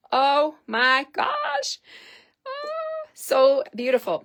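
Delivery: high, loud, slow gushing squeal; thrilled squeal. Real, not acted. Crafter squealing over sparkly sequins to her viewers. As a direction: squeal with delight, stretching it out.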